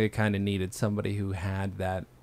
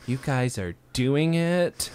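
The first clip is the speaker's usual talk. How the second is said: slow, higher, drawn-out realization; mock astonishment; 5 semitones higher, 3 dB louder, much slower than usual. Host voicing the staff learning of the romance, mock-astonished. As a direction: stretch it out as if it just dawned on you.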